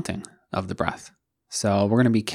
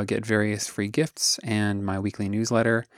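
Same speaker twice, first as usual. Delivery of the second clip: usual speaking voice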